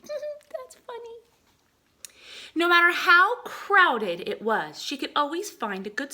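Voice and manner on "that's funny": very high, slow, laughing squeak; high giggly voice after a laugh. Real, not acted. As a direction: giggle through it.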